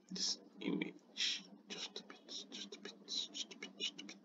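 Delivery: under-the-breath whisper, talking only to yourself; whispered to self. Real, not acted. Creator nudging an image in Canva, whispering to herself.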